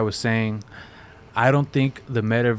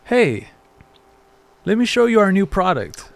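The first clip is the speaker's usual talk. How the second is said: high, louder salesy pitch; mock the fake ad presenter, pitched up; fake commercial voice; 9 semitones higher, 4 dB louder than usual. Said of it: "being so fake".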